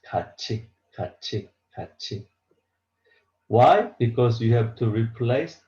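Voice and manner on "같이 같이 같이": 같이 read as gachi, the T softened to CH; 같이 repeated softly as ga-chi, fading each time; model pronunciation. Shown as a demonstration. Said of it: "read this one like this".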